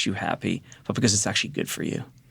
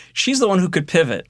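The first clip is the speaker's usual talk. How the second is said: normal talking voice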